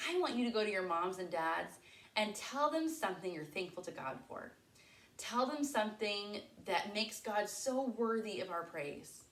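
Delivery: ordinary talk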